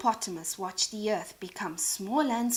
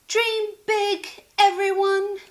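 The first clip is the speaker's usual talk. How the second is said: high, loud call to everyone; rallying cheer; 8 semitones higher, 7 dB louder, slower than usual. Acted. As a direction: inspire the crowd. Sheila cheers before launch.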